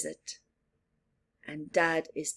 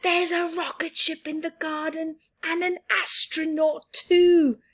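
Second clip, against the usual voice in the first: excited; 9 semitones higher, 5 dB louder than usual. Acted. Child repeats excitedly.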